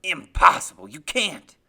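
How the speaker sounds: very loud, low, forceful outburst; furious denial. Acted. Father refuses to believe his son remembers, furious low voice. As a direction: explode at the boy.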